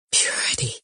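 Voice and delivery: whispered in awe; an echoed Purity, whispered on no voice; breathless whisper. A narrator's reading. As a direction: barely breathe the word Purity. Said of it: "breathless and speechless".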